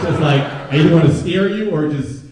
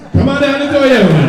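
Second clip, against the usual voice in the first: much higher and louder hammed-up salesman voice; pushy car ad pitchman; 14 semitones higher, 12 dB louder than usual. Comic mocking a Toyota Easter commercial, loud pitchman. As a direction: bellow it like a cheesy dealership ad.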